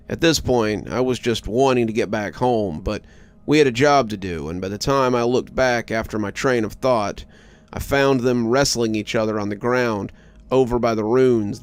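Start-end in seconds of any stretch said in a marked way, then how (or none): none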